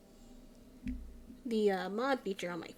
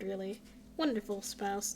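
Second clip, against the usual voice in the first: ordinary talk, nothing marked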